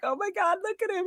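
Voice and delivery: high, soft gushing squeal; breathless high-pitched adoration. Retold or imitated. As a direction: coo over him like a starstruck fan. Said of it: "And I'm like".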